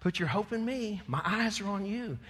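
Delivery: put-on calm a frightened child; God comforting. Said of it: "But God says".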